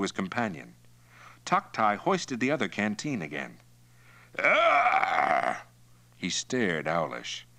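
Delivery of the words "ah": high, long exhaled "ah"; loud drinker's "ah". Acted. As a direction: sigh it out loudly after a big swig. Satisfied gasp after drinking.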